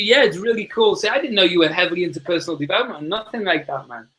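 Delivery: ordinary delivery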